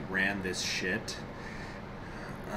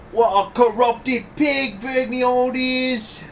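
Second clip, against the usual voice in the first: high Cockney testimony, loud at the end; Cockney accent; 12 semitones higher, 6 dB louder than usual. Acted. Witness complaining of Vergniaud to the judge, high indignant Cockney. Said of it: "this is the Cockney accent here".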